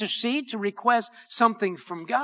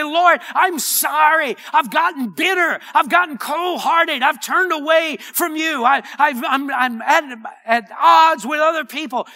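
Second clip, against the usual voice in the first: louder, higher, heartfelt confession; repentant cry; 4 semitones higher, 4 dB louder than usual. Acted. Preacher confessing to the Lord as a sinner, crying out in repentance. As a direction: pour out the apology as a cry to God. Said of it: "We need to cry out and say".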